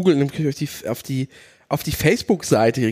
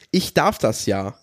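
mock smug quote; 7 semitones lower, slower than usual. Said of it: "homophobe Aussagen damit zu beginnen mit".

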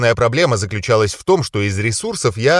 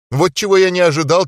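ordinary delivery

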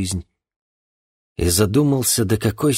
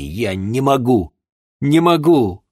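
anguished cry; 5 semitones higher, 5 dB louder than usual. Acted. Refuses to shoot.

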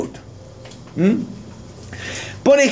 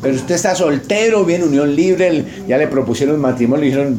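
ordinary talk

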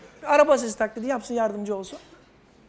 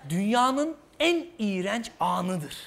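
slow grim pronouncement; 3 semitones lower, 6 dB quieter, slower than usual. Acted. Acted offended sulk after embarrassment.

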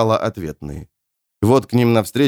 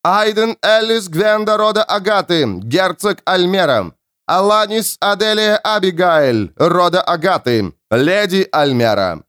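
town crier; 9 semitones higher than usual. A narrator's reading. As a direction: declaim titles like a court herald. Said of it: "Герольд продекламировал".

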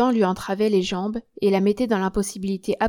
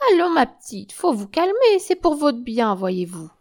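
cricket voice, good-natured; 4 semitones higher, 4 dB louder than usual. Acted. Joséphine reasons with the bound patient, in a good-natured cricket voice. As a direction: soothe as if to a cross child. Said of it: "de sa voix de criquet, avec des inflexions bonasses".

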